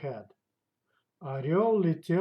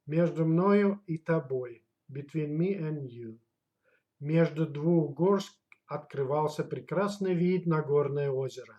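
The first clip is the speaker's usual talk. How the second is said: normal talking voice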